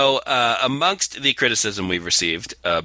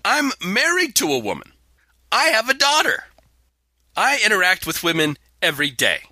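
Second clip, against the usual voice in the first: mock indignation; 9 semitones higher than usual. Host defends himself against hate mail, mock indignant.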